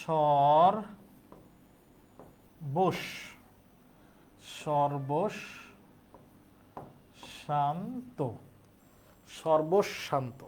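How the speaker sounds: সর্বশান্ত in low, long-drawn pieces, "shor... bosh... shan-to"; slow pronunciation demo. Shown as a demonstration. Teacher shows students how it sounds, সর্বশান্ত slowly sounded out. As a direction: build up সর্বশান্ত slowly with long pauses between parts. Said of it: "উচ্চারণটা হবে এরকম".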